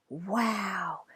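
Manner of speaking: breathy whispered wow; awed whisper. A narrator's reading. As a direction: hush it, amazed. Awed in quiet cave.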